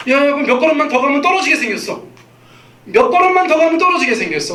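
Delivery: urgent warning call. Acted. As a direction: warn the toddler before the fall, voice up high. Parent warning a toddler at edge.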